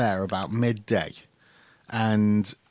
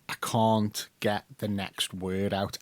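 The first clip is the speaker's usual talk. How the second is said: halting slow; 3 dB quieter, slower than usual. Retold or imitated. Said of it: "I'd stop dead".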